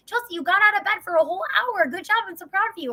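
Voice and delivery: overpraise her like a kindergartner; praising a child. Retold or imitated. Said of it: "Or, like".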